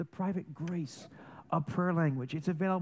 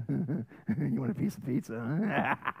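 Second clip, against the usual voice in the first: mock father voice; 6 semitones lower, much slower than usual. Father teasing child, mock voice.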